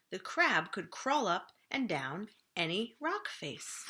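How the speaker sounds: usual speaking voice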